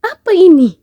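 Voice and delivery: high, pleading servant, begging not to be dragged off; panicked pleading cry. Acted. (hamba memohon dengan panik dan nada tinggi kepada Allah)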